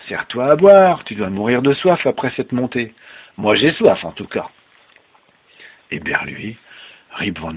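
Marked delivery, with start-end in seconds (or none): 0.1-4.5 loud, lightly voiced drinking companion; hearty host. Dwarf pouring Rip a drink, hearty host. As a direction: urge the guest on, louder.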